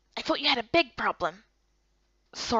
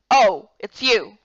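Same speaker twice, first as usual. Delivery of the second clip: louder, slow cool greeting; a curt, unimpressed elder woman, louder; stern Miko; 2 semitones lower, 9 dB louder, much slower than usual. Acted.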